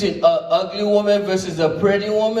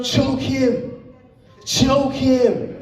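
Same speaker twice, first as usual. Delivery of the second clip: mock egging on; 5 semitones higher, much slower than usual. Girlfriend cheers on attacker.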